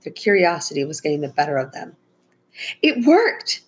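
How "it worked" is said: high, excited burst; exclaiming. A narrator's reading. Girl sees the trap has worked, excited exclaim. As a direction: cry it out, pitched way up. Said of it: "Becca exclaimed".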